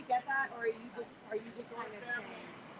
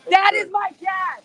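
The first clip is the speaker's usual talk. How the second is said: excited; 5 semitones higher, 7 dB louder than usual. Real, not acted. Spots her father, repeats.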